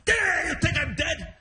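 high, growled-out indignant cry; mock angry. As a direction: imitate a furious dad taking offence. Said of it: "he was so mad".

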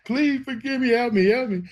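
very high, small pleading voice; high-pitched acted plea for mercy; imitated tearful begging. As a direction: beg like a crying child, retold. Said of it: "I'm crying and I'm telling him everything".

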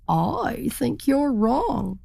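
drawn-out turkey insisting; slow turkey voice. A narrator's reading. Stubborn turkey reply.

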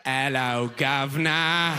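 hammed-up Cockney greeting; mock British. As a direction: parrot a bad British accent, drawn out. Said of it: "when you meet somebody British".

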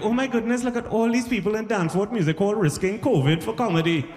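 put-on high-pitched Trinidadian accent; mock-amazed Trinidadian lilt; Trini wife. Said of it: "I married a Trini woman".

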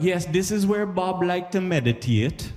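high, slow, reverent guide voice; tour guide voice. Retold or imitated. Jamaican tour guide to visitors, in a high, slow, reverent voice. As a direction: lift it up like a pious museum guide. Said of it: "they'd be like".

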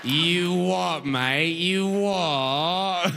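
hammed-up, stretched British catchphrase; mock British accent. Gawker mimics a British person, mock accent. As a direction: parrot British slang in a silly accent. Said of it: "when you meet somebody British".

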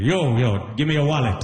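put-on a light Trini accent, rising high on the wallet; Trini mugger. Trini man tries robbing someone, in a mild Trini accent. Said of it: "The Trini accent isn't intimidating".

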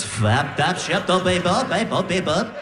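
garbled, looping gibberish syllables; record played backwards. Comic mocks wife's sleep babble, like a backwards record. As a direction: wobble nonsense like reversed vinyl. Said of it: "Sounds like you're turning a record backwards".

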